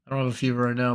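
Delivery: ordinary talk, nothing marked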